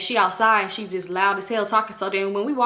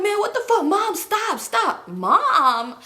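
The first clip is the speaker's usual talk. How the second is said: pleading son; 10 semitones higher, much slower than usual. Retold or imitated. Son begs his mother.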